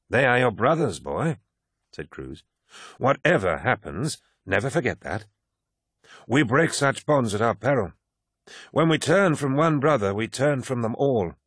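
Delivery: put-on garrulous old warrior holding forth; old veteran Cruz. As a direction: lecture the young man like a proud old-timer. Narrator voices old Cruz.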